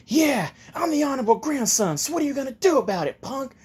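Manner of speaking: high, bratty boast; cocky defiance. Acted. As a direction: dare him like a spoiled kid. Kid defies Naruto, flaunting his title.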